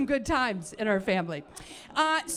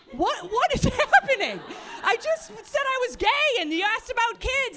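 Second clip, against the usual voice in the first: much higher and louder, laughing in disbelief; incredulous disbelief; 11 semitones higher, 6 dB louder than usual. Retold or imitated. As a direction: cry out in baffled outrage, laughing. Disbelief at mom's topic change.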